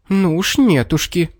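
high, teasing refusal; defiant fox. A narrator's reading. Fox will not give up the cap, high defiance.

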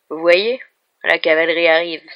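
loud sheriff announcing rescue with a broad smile; triumphant, grinning. A narrator's reading. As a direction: proclaim it loudly, with a grin. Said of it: "eut un large sourire".

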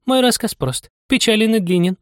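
very high mouse voice; a high, squeaky mouse sighing over its tale. A narrator's reading. Mouse telling Anya of its sad tale, sighing. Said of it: "со вздохом сказала мышь".

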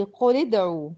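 slow, joined "قل ادعوا" as quli-d'u; measured tajweed example, "قل ادعوا" run together with i; demonstrating the kasra link. Shown as a demonstration. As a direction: link "قل ادعوا" with a short i on the lam. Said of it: "زي إيه؟".

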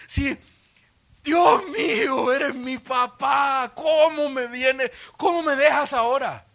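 high, loud, slow forsaken wail; Jesus on the cross shouting in despair; anguished cry to God. Acted. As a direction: cry out like a son abandoned by his father.

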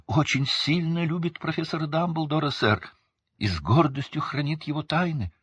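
high-pitched, earnest little elf; squeaky elf. A narrator's reading. Dobby professing love for Dumbledore, squeaky and high. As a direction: squeak out proud devotion. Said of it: "снова запищал он".

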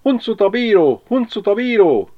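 high, loud teasing cry; gleeful shout. Acted. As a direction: crow it out like a taunting rhyme. Berti mocks, shouted quote.

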